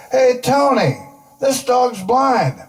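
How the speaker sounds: high, slow mock yell at Tony; yelling out the window. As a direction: call it out the window, drawn out. Yells out the window.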